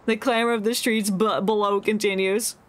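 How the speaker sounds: ordinary talk, nothing marked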